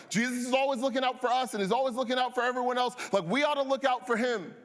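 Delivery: high, eager disciple voice, a bit fast; hammed-up eager followers fussing over Jesus; mock earnest disciples. Disciples fussing over tired Jesus, high and eager. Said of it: "they're going like".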